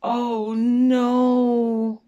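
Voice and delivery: loud dismayed oh no. Acted. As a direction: cry out at the shock. Dismay at eaten treats.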